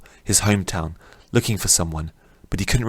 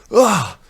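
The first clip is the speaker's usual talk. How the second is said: loud, high cry on waking; waking from a nightmare shouting; startled shout; 13 semitones higher, 5 dB louder than usual. Acted. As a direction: jolt awake with a yell. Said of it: "sitting up violently and shouting".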